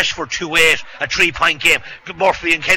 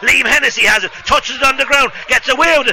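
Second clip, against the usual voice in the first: higher, urgent live call; excited play-by-play; 7 semitones higher than usual. Real, not acted. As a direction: ride the play as it unfolds.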